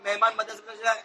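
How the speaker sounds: fast, run-together recitation; quick, careless demo of how not to say it; rushed wrong way. Shown as a demonstration. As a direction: rattle the words off without separating them. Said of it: "ایسا نہیں بولے گا".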